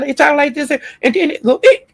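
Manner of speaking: mocking high, squeaky teenage voice. Mocking a man's unchanged voice, squeaky. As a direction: jump way up into a cracking boyish squeak. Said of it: "your voice be squeaking".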